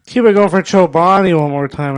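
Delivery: ordinary talk, nothing marked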